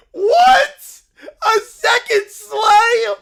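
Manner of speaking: very high, loud slow shout; stunned yell; shocked outcry. Acted. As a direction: scream it in disbelief. Acted shock at the news.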